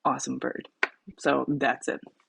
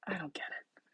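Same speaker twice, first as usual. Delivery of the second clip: whisper; 17 dB quieter than usual. Real, not acted. Confused by reversed slide.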